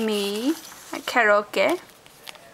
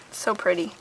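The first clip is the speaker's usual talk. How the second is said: usual speaking voice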